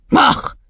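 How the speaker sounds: high, short snort; scornful snort. Acted. Headmistress amazed by the girl, scornful snort. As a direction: snort it out in disdain.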